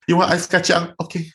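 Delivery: high, coaxing Singaporean grandma; grandma spoiling a frowning grandchild, coaxing; doting grandma. Retold or imitated. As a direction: coax like a fussing grandma offering treats. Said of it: "my grandma go".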